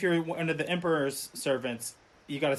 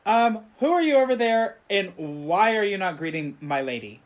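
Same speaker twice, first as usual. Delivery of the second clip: raised, higher voice, mildly mock-scolding; retold indignant shout; 6 semitones higher, 6 dB louder than usual. Maid calling out the stranger for not greeting her lady, mock indignant shout. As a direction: call out a haughty challenge, then ease off. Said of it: "she shouts out and she's like".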